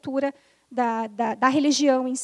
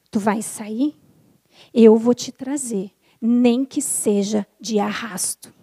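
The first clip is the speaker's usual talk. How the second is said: lower, louder, slow menacing spirit voice; slow, lower, louder threat from a possessed spirit; distorted horror voice; 4 semitones lower, 6 dB louder, slower than usual. Retold or imitated. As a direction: growl it like a horror-film entity. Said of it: "com uma voz totalmente distorcida".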